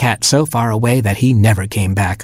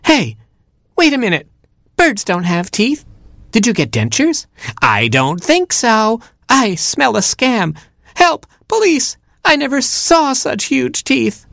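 very high, squeaky bird in rising panic; alarmed Little Robin; 13 semitones higher than usual. Acted. Robin smells a scam and calls police, high alarmed voice. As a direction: start suspicious, build to high cries for help.

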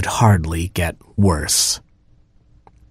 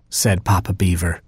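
ordinary delivery, nothing marked

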